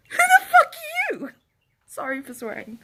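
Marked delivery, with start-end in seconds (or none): 0.1-1.3 high, loud indignant outburst; shouted, offended challenge; outraged retort. Retold or imitated. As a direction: fire it back in loud, high-pitched disbelief. Retold outraged retort to a guest.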